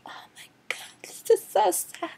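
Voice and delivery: whispered then squeaky high mock dismay; mocking a weepy teen; mock sad gasp. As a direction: sneer at her tears in a squeaky voice. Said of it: "and she's like".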